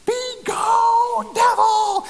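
high, loud faith-healer scream; intense scream. Retold or imitated. Prayer leader over a sick man, screaming. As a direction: scream it out as a full-force command. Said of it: "very intense scream".

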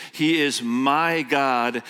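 usual speaking voice

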